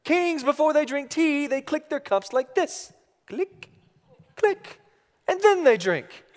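put-on very high little-girl voice, correcting; little daughter. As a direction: imitate a bossy small child instructing her dad. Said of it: "And she says".

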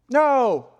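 loud, high refusal; emphatic no. Acted. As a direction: blurt a forceful no at God. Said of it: "the no is emphatic".

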